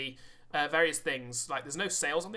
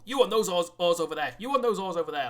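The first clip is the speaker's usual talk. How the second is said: loud, raised-pitch crew commands; ordering people; 4 semitones higher, 9 dB louder than usual. Acted. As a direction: call orders across the deck. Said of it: "starts ordering people".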